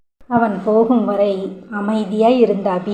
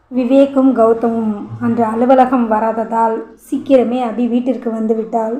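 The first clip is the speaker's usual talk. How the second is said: ordinary delivery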